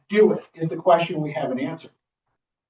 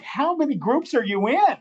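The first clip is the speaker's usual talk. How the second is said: surprised question; 9 semitones higher than usual. Retold or imitated. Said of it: "I'm like".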